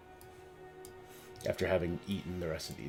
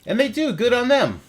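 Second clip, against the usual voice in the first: excited; 14 semitones higher, 10 dB louder than usual. Acted. GM cheers the swarm.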